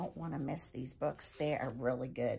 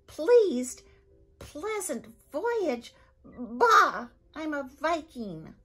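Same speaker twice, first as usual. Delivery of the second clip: loud, much higher disgusted Viking roar; 8 semitones higher, 8 dB louder than usual. Acted. Viking father mocking his son's polite words, disgusted roar. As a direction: spit the words back in scorn. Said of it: "screwed up his face in disgust".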